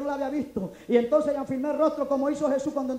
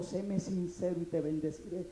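slow, much softer, lower solemn voice; voice of God; 6 semitones lower, 9 dB quieter, slower than usual. Retold or imitated. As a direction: deliver it hushed and low like a divine whisper. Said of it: "Y Dios me dijo".

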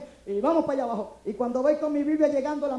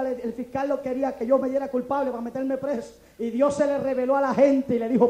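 ordinary delivery

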